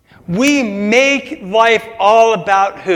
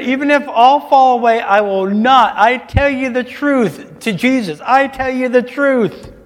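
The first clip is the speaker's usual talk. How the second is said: boastful Peter; 6 semitones higher than usual. Retold or imitated. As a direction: proclaim it like a proud disciple. Preacher acting out Peter's boast.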